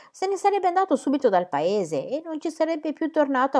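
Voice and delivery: high, loud, outraged tirade; indignant outburst. A narrator's reading. Sir James railing at Ladislav's lack of honour, indignant outburst. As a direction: let outrage push the voice right up. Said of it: "ancora al culmine dell'indignazione".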